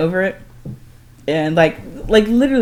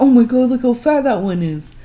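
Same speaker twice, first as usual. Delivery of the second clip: high, louder excited squeal; excited gasp; 7 semitones higher, 4 dB louder than usual. Retold or imitated. Sister-in-law gawking at a fat gator, high excited voice. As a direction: imitate her thrilled amazement. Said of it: "And she's like".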